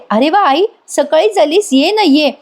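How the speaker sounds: light up as the aunt walks in; happy welcome. A narrator's reading. Sayali greets aunt Pratima with happy surprise. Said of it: "Sayali खुश होऊन म्हणते".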